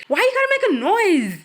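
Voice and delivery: high, loud mocking shriek; mock yell. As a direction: screech it back at the yeller. Mocking a yelling adult.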